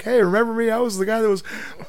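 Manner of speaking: high, gushing imitation of a fan; mock fangirling. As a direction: gush like a starstruck fan, hammed up.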